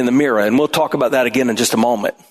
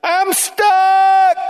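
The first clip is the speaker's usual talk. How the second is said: mock whine; 3 dB louder than usual. Sheep-like person complaining.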